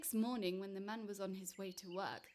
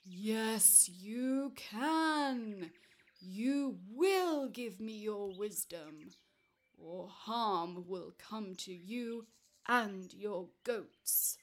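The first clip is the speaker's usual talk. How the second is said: slow, loud, drawn-out threat; angry giant's roar; 7 dB louder, much slower than usual. Acted. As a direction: roar it slowly as a menacing giant. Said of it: "and he roared".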